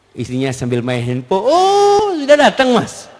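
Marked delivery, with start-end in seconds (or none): 1.4-3.1 high, drawn-out "oh" then greeting; mock a wife looking up from her phone, high-pitched; distracted wife's greeting. Wife on her phone to arriving husband, distracted greeting.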